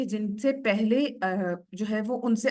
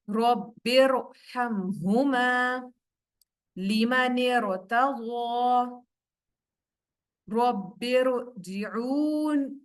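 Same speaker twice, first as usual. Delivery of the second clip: slow, drawn-out tajweed recitation; Quran recitation; much slower than usual. Shown as a demonstration. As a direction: recite each syllable slowly with heavy r. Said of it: "पीछे पीछे practice कीजिए". (धीमी तिलावत)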